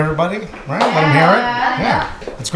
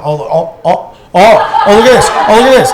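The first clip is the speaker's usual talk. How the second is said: louder and higher, mock-triumphant crowing; boastful shout; 4 semitones higher, 6 dB louder than usual. Husband showing off to wife, loud mock triumph. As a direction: crow over it like a hero.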